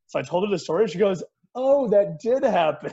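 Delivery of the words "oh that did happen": high, loud, drawn-out realization; re-enacted astonished exclamation, slow and raised; imitated amazed reaction. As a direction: stretch it out in mock amazement. Acting out her surprised reaction.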